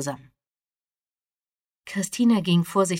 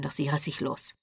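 ordinary delivery, nothing marked

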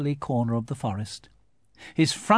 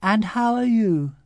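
higher, bright little bear voice; cheerful Pooh; 8 semitones higher, 4 dB louder than usual. A narrator's reading. Pooh asking Eeyore kindly, higher and lighter. Pooh's voice.

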